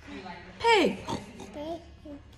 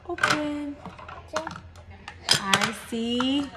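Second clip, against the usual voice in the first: ordinary talk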